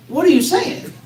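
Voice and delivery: baffled exclamation. Acted. Baffled listener to an unclear speaker, mock exasperation.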